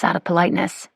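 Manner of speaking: ordinary delivery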